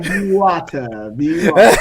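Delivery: lightly voiced Bruce Lee quote; mock Bruce Lee. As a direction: drawl it out like a martial arts master. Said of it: "but Bruce like".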